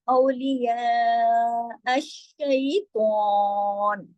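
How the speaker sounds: slow, stretched recitation; slow Quranic recitation. Shown as a demonstration. Teacher reciting the verse for students, slow chant. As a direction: chant it slowly and evenly. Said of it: "इस तरीके से".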